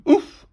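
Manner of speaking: loud, high "oof"; short explosive wince of impact; impact grunt. A narrator's reading. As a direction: grunt as if hitting the ground. Teacher sliding onto the ground.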